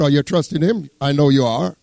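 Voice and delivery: ordinary delivery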